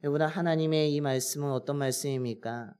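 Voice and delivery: ordinary delivery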